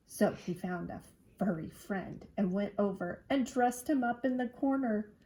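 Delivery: ordinary delivery, nothing marked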